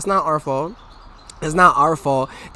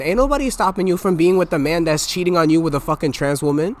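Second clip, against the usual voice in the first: usual speaking voice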